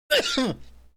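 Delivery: loud spluttering cough; coughing up water. Real, not acted. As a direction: cough hard to clear the throat. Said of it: "My water went down the wrong pipe".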